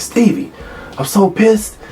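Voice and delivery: high, strained, worked-up player voice; imitating an outraged teammate swearing he'll block the kick; hysterical, pissed-off teammate. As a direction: mimic a furious lineman venting on the field. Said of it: "he's hysterical".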